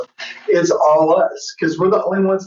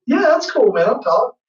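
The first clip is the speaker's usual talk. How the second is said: much higher, easygoing quoted reply; 6 semitones higher than usual. Retold or imitated. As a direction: toss off a casual yes like an old songwriter. [name] quoted agreeing.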